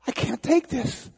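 acted despair after sobbing; distraught. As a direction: plead at the end of your rope. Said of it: "He's sobbing".

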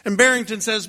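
ordinary delivery, nothing marked

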